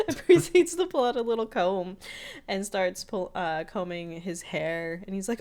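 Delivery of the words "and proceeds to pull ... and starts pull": ordinary talk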